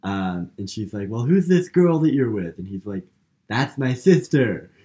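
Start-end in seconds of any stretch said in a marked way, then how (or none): 1.1-2.6 high, loud mock interrogation; jealous accusation. Acted jealous question in retold quarrel. 3.5-4.7 loud, high mock protest; indignant retort. Acted indignant answer in retold quarrel.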